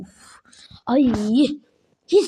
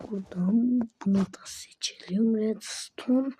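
secret plotting whisper; 4 semitones lower, 7 dB quieter than usual. Real, not acted. Whispering his TNT plan to viewers, conspiratorial whisper. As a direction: hush it so nobody hears. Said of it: "secret TNT plan whispered".

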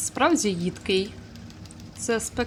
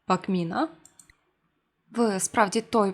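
usual speaking voice

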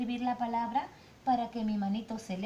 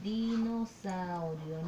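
writing-pace word; 3 semitones lower, slower than usual. Shown as a demonstration. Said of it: "Y se escribe así".

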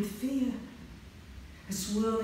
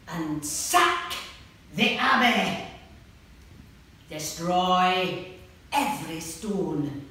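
wild battle shouts, much louder; shouting; 8 dB louder, slower than usual. Acted. Big red-haired man urging his men, fierce shouting. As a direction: bellow the order to attack.

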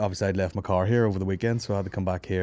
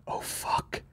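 whispered oh no; 11 dB quieter than usual. Retold or imitated. Maureen realises pram left behind.

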